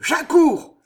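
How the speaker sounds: loud, high-pitched roar. A narrator's reading. Chevalier answering the young woman's cry for help, roaring. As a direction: roar it out at full voice. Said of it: "rugit Pardaillan".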